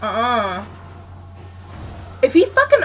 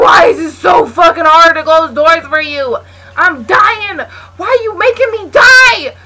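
loud, higher, screaming at teammate; frustrated yelling; 6 semitones higher, 10 dB louder than usual. Real, not acted. Yelling at the teammate who won't close doors, furious shout. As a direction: yell in panic and anger.

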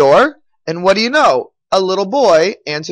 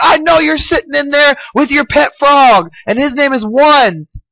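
high-pitched, taunting demand through the door; infuriated mayor; 7 semitones higher than usual. A narrator's reading. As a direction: act out an angry man who knows who's inside. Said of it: "the mayor is infuriated".

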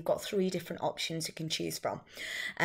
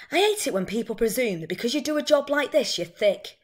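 indignant waitress, higher and louder; Carol; 5 semitones higher, 5 dB louder than usual. Acted. Waitress complains directly to the audience, indignant. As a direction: vent resentment at being presumed thick. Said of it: "the one I've chosen is Carol's monologue".

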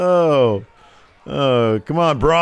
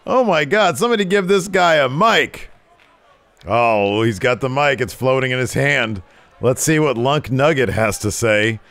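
lower, slow ring announcer voice; hyping a wrestler's entrance, lower and slow; 8 semitones lower, slower than usual. Acted. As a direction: build up the entrance like a commentator. Commentating the entrance.

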